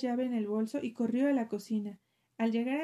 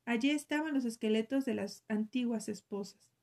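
usual speaking voice

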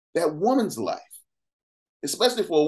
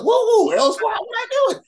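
high, loud mock panic; mock alarm; 5 dB louder than usual. Host retelling his reaction to the judges, mock alarm. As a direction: squeal it like you've been caught out. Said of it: "I was like".

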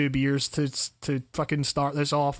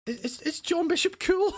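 incredulous; 13 semitones higher than usual. Real, not acted. Incredulous at friend's opinion.

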